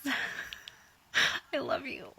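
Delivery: whispered laughing yes; giggling whisper of delight; laughing whisper, answering Sirius with a crush; giggly breathy reply. Retold or imitated. Retold eager reply to Sirius.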